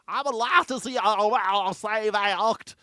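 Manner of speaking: high, loud, drawn-out mock Southern accent; mocking Southern senator accent. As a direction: ham up the old senator's Southern accent, slowing on save Act. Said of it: "their accent gets even weirder".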